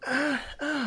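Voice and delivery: high breathy mock exertion moans; imitating Lara's moans. Mocking the heroine's effort, a high put-on moan. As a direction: ham up a game heroine straining. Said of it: "she's like".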